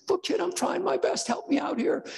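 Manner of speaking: put-on very high, pleading voice to the crying baby; frazzled parent. Parent at night pleading with the crying baby, high and frazzled. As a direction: beg the baby for help, high and strained. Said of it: "You say".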